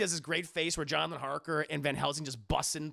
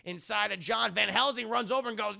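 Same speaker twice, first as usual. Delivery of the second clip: usual speaking voice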